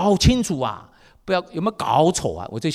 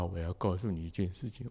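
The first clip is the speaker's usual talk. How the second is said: 1 sheepish son; 8 semitones lower, 11 dB quieter than usual. Retold or imitated. Son confesses quietly.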